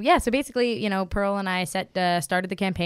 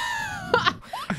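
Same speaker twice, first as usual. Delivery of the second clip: high nervous squeal of a laugh; nervous shriek laugh; 3 dB quieter than usual. Real, not acted. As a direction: let out an awkward squealing laugh. Awkward meetup memory.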